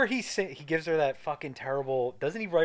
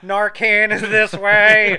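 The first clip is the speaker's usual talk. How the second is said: loud, high, drawn-out mock announcement; hospital greeter; 7 semitones higher, 12 dB louder, much slower than usual. Hospital greeter directing visitors, loud singsong-slow announcement. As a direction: call it out slowly, stretching the words.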